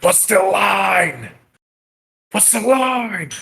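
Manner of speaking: a decrepit little sorcerer feeding lines; old kobold sorcerer prompting the dragon under his breath. Acted. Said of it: "and he goes".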